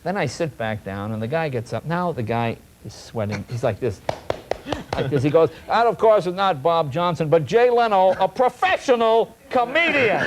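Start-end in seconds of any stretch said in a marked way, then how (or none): 5.7-10.2 mock salesman. Salesman unmasking the comedian.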